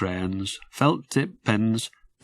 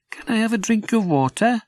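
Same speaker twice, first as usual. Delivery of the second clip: put-on high, puzzled little-boy voice; a small child wondering aloud; small boy Jim; 11 semitones higher than usual. Little Jim asks his sister why it's dark, high boy's voice. Jim's voice.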